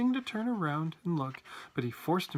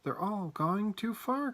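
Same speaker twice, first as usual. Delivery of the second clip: high, drawn-out exclamation; slow, lifted note of alarm; alarmed cry; 7 semitones higher, slower than usual. A narrator's reading. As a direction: cry out in dismay. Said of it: "cried Charlie".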